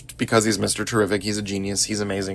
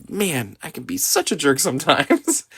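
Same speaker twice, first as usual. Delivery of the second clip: sheepish Clark, higher; 6 semitones higher than usual. Retold or imitated. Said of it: "and Clark says".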